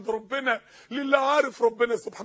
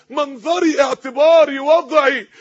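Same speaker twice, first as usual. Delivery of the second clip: rising to a loud mocking outcry; building mock outcry; 2 semitones higher, 7 dB louder, much slower than usual. Preacher mocking rich girls' excuses, building shout. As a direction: shout the excuses louder each time.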